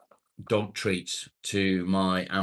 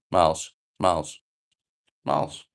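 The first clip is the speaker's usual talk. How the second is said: calling the dog; 7 dB louder than usual. Real, not acted.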